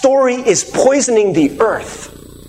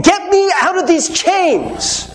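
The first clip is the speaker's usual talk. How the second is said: higher, slow plea to be freed; desperate plea; 7 semitones higher, slower than usual. Retold or imitated. Preacher voices a slave begging, pitched high and slow. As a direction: beg to be let out of the chains.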